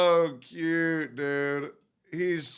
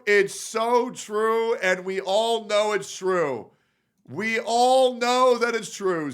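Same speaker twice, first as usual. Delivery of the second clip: louder, higher, punchy hype; emphatic hype; 6 semitones higher, 5 dB louder than usual. Real, not acted. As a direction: hammer it home like a hype man. Streamer hyping reaction clip.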